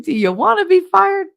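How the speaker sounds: loud, high exasperated question; incredulous scolding. Retold or imitated. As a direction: act out warning a reckless colleague. Scolding colleague with incredulous question, retold.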